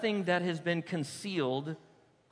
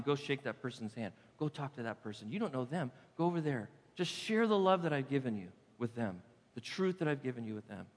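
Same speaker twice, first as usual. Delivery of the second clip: soft, low voice of God urging gently; whisper of God; 6 semitones lower, 6 dB quieter than usual. Retold or imitated. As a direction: murmur God's nudge, low and soft. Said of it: "I heard the whisper of God saying".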